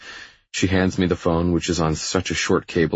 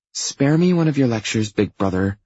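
high-pitched sisterly put-down; dismissive, higher female voice; dismissive little sister; 7 semitones higher, 3 dB louder than usual. Acted. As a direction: brush off big brother, pitch way up. Sister dismisses his lecture.

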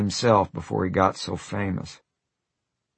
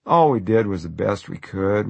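ordinary delivery

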